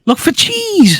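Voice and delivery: high, drawn-out exclamation; Pim's excited suggestion. Acted. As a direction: announce it like a grand idea, voice soaring. Acting Pim's bright idea.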